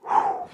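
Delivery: high, airy exhale; overwhelmed puff of breath; whew. Real, not acted. Overwhelmed pause in sermon.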